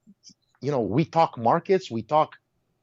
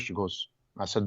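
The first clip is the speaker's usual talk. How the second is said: ordinary delivery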